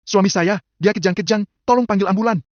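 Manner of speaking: high-pitched panicked plea; urgent order. A narrator's reading. Wife orders the servant to get an ambulance, panicked. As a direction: break it into short, urgent bursts. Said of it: "Perintah Cassandra langsung".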